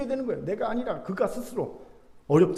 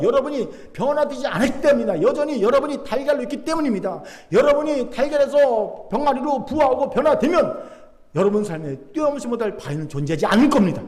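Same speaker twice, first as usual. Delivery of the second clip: loud, raised sermon climax; full-force preaching; 6 semitones higher, 6 dB louder than usual. Real, not acted. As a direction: drive every phrase home to the congregation. (높고 힘찬 설교 절정부 외침)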